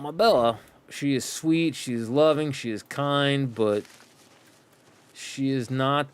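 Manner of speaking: usual speaking voice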